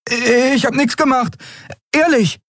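put-on very high-pitched innocent boy voice; protesting boy. A narrator's reading. Boy denies touching anything, high protest.

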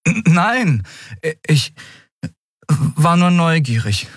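flustered. Acted. Miko denies having hopes, flustered and stammering. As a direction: stammer it out, hesitating between words.

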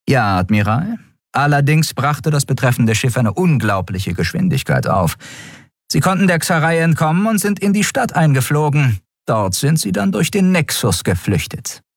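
high-pitched officer's report voice; dutiful first officer. A narrator's reading. Shia reporting to the admiral, dutiful.

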